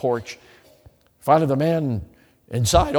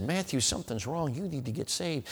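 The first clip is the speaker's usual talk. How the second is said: soft pleading quote; 11 dB quieter than usual. Retold or imitated. Sister pleading on the phone.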